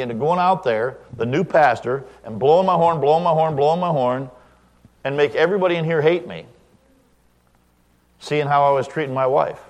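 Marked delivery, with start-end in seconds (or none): none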